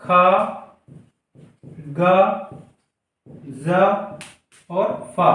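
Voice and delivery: खा गा जा फा spelled out as throaty ख़ ग़ and buzzing ज़ फ़; Urdu nuqta sounds. Shown as a demonstration. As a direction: say each nuqta letter slowly and clearly. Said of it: "अभी हमने बताया का". (नुक्ता वाली ध्वनियाँ स्पष्ट बोलें)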